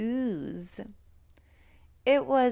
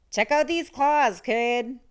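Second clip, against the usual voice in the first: higher, louder rasp of a proud monster; 4 semitones higher, 5 dB louder than usual. A narrator's reading. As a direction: rasp it out boastfully. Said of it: "a third voice from under the bed rasped".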